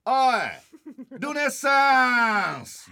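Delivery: high, drawn-out taunting call; mock teen heckle. Teen heckling the middle-aged man, slow high mock taunt. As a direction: heckle like a cheeky teen showing off. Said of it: "言われて".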